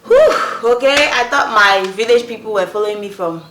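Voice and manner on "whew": high, loud sigh of relief; mock relieved exhale; relieved whew. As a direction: blow out a big whew of relief. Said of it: "Okay, I was like".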